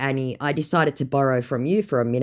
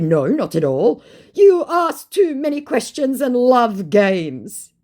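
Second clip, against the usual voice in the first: higher, louder, slow on the second line; mock scammer; 11 semitones higher, 7 dB louder, slower than usual. Mocking the scammer's scolding, slow and pompous. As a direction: slowly lecture like a smug con man.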